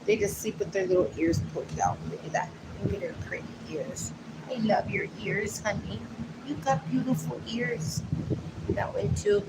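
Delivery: very soft, hushed murmur; soft cooing. Real, not acted. Admiring the sleeping dogs' ears, soft and hushed. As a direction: coo quietly to a sleeping pet.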